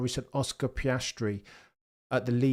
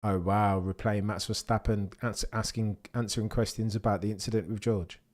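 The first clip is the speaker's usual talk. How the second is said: usual speaking voice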